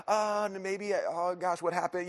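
high-pitched fretting; mock worry. Acts out his anxious guessing.

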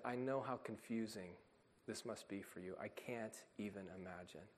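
soft fatherly comfort; tender comfort. Retold or imitated. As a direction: hush it down as if consoling a little girl. Father comforting crying child.

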